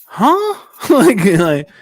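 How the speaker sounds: very high squeaky huh; a stunned, high-pitched huh; mock baffled huh. Coworkers baffled by his weekend, high mock huh.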